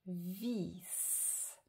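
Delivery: VIS with a long final s; drawn-out word. Shown as a demonstration. Teacher reading back vis for pupils, drawn out. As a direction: stretch vis into a lingering hiss. Rereads word while pointing.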